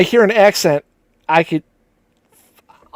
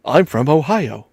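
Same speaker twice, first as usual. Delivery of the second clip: lower-pitched, self-mocking Ohio accent; 3 semitones lower, 4 dB quieter than usual. Shown as a demonstration. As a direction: show off your Ohio accent. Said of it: "'Cause I live in Ohio".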